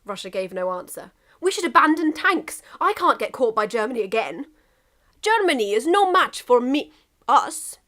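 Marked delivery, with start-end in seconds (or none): 1.4-7.8 character dialogue. Acted. Characters argue about fleeing.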